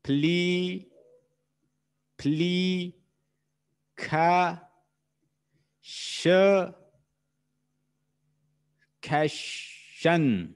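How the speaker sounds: "pli, kha, sh, kashan" drawn out, separated by pauses; syllable by syllable. Shown as a demonstration. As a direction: sound out each piece of "pli, kha, sh, kashan" alone. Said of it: "How many vowel sounds are in simplification".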